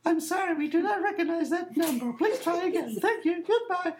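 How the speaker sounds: chirpy recorded rejection, mocked; automated phone voice. Caller mocking the voice rejecting his number, high and prim. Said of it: "and the voice said".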